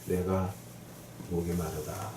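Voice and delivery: low, soft and halting; hushed quote. Acted. Preacher quoting Jesus's thirst before death, hushed and low. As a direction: drop low and quiet for the dying words.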